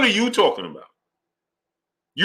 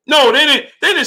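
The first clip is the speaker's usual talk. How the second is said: high, loud no; flat-out deny it; heated rebuttal, pitched up; emphatic denial; 9 semitones higher, 5 dB louder than usual. Real, not acted. Rebutting again.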